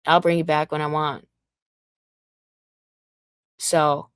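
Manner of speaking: ordinary delivery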